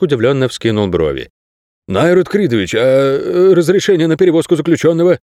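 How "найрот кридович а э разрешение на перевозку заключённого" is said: higher, halting query with filler sounds; hesitant guard. A narrator's reading. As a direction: question a superior uncertainly, stumbling before the request. Guard hesitantly questions his superior. (неуверенный вопрос охранника, с запинкой)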